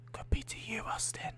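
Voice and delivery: breathy teasing whisper to Austin. Acted. Whispering a tease to a chat viewer named Austin. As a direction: hush it like a secret.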